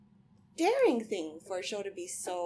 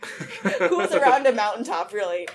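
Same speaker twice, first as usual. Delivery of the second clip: laughing; 2 semitones higher, 11 dB louder than usual. Real, not acted. Laughing at own joke.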